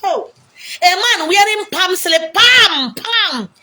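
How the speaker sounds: high, loud, drawn-out exclamation; mock outrage. Real, not acted. Preacher mocking a man's palm slippers, loud and high. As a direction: scoff at a man in palm slippers.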